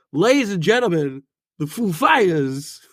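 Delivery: halting, oddly stressed impression; Christopher Walken. Shown as a demonstration. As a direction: mimic an eccentric actor's swooping emphasis. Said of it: "This one is Christopher Walken".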